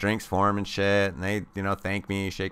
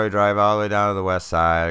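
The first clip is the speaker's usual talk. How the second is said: normal talking voice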